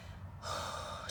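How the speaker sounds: soft audible exhale; long breathing sigh. Shown as a demonstration. Reader shows the Mother of Wands how to breathe, soft sigh. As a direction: let a slow breath out before speaking. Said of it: "just breathing".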